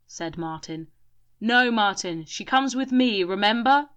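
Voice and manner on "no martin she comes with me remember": loud, higher shout. A narrator's reading. Grim shouting at Martin, loud. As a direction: insist loudly. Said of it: "shouted Grim".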